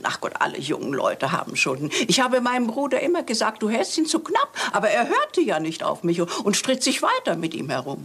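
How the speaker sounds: ordinary talk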